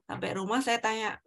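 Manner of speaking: ordinary talk, nothing marked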